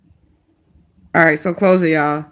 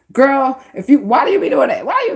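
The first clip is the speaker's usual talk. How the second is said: higher-pitched, exasperated questioning; frustrated guardian; 6 semitones higher than usual. Retold or imitated. Guardian spirit scolding the querent, imitated frustration. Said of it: "that's exactly how he said it".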